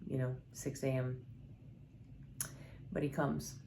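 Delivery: ordinary delivery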